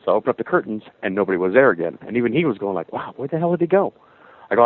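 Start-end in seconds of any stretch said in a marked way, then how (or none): 2.9-3.9 higher-pitched, mildly amazed quote; lightly acted astonishment; retold bafflement. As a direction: imitate a friend's puzzled surprise. Retelling friend's baffled reaction.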